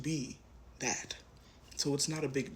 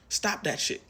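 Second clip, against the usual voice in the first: raised, high, firm scold; emphatic scold; 6 semitones higher, 4 dB louder than usual. Real, not acted. Reader scolding the viewer's self-doubt, raised and firm. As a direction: snap at the viewer to quit it.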